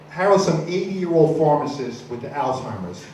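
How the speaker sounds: normal talking voice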